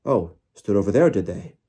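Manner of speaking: loud, sceptical retort; disbelieving. A narrator's reading. Official doubting the girl's account, disbelieving. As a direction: throw the words back in disbelief. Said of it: "disbelief etched all over his face".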